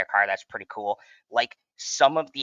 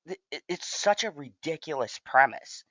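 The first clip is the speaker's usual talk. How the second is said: normal talking voice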